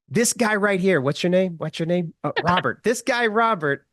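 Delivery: high, emphatic celebrity impression; Donald Trump. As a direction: announce it grandly to a whole room. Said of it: "This is my Donald Trump impression".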